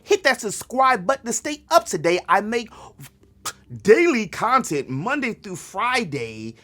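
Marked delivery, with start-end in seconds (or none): none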